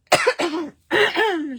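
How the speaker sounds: sharp, loud throat-clearing coughs; real coughing fit. Real, not acted. Crafter coughing mid-demo with a frog in her throat, loud coughs. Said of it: "J'ai un chat dans la gorge".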